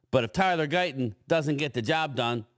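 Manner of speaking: ordinary delivery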